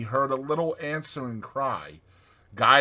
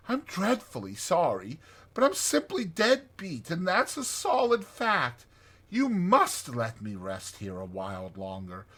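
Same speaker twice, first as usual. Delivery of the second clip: tired pleading; 6 semitones higher than usual. A narrator's reading.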